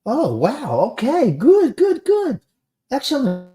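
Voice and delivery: high, swooping, gleeful reaction; real delighted surprise. Real, not acted. Streamer reacting to a friend finding the story, delighted. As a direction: let the pitch soar with pleased surprise.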